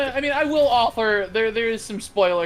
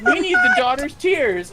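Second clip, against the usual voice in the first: higher, mock-serious parents; a hint of a scheming parent; 7 semitones higher, 3 dB louder than usual. Parents deciding they need her tears, mock-serious. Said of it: "So, like".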